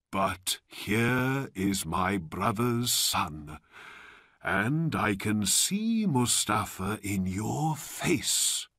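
put-on slow, deliberate old man; Abanazar. A narrator's reading. False uncle greets nephew, slow and solemn. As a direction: draw it out with solemn affection.

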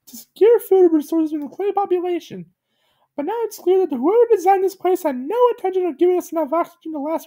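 very high, solemn cartoon voice; squeaky grave monologue; Gumby's high narration. A narrator's reading. Gumby narrates the Dead Star, high solemn voice.

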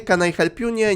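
normal talking voice

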